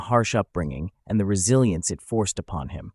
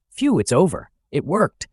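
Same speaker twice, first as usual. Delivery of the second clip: higher-pitched burst of relief; relieved exclamation; 6 semitones higher, 3 dB louder than usual. A narrator's reading. An ascetic after the ritual succeeds, exclaiming with relief. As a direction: let the tension go, pitched up. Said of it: "exclaims with relief".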